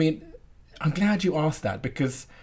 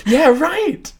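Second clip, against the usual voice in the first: excited; 9 dB louder than usual. Real, not acted. Excited agreement with host.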